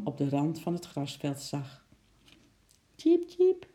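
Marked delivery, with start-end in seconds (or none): 2.9-3.7 very high squeaky tjiep; squirrel squeak. A narrator's reading. Little squirrel Eki calls out lonely, high squeaky peep. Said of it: "piepte Eki zachtjes".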